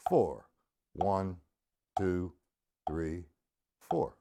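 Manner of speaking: low, evenly spaced counting, one number per beat; slow quarter-note count. Shown as a demonstration. Drum teacher redoing line one, slow measured count. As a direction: concentrate and count slowly, keeping strict time. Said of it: "line one again in quarter notes".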